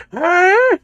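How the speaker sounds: put-on high, loud old man huh; a deaf old man's huh. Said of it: "I gotta adopt the old man voice".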